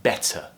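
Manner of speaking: "Better" with a crisp true T; clear T. Shown as a demonstration. Teacher repeats the example for learners, "Better" with clear T. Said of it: "very clearly".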